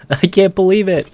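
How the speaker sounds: high, loud laughing cry; real laughing dismay; laughing disbelief. Real, not acted. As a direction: laugh out the shock. Cat died, laughing disbelief.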